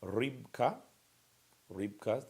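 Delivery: Rybka said clearly, stress on RYB; teacher models rybka for students to repeat; model pronunciation. Shown as a demonstration. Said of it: "beginning the pets here".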